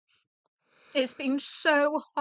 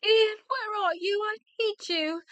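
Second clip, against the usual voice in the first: tearful; 9 semitones higher than usual. Acted. Searching for lost husband, crying.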